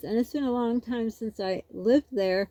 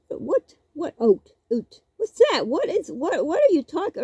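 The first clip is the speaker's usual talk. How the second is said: teasingly bewildered; mock confusion; 6 semitones higher than usual. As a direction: play the puzzled kid, over the top. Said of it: "I says".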